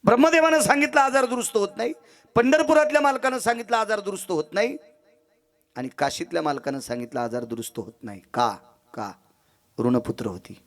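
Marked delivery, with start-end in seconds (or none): 0.0-4.8 high, ringing refrain ending on a rise; declamatory preaching. Acted. Preacher announcing each god's verdict, declamatory and high. 5.8-8.2 subdued conclusion. Acted. Last verdict drops from declamation.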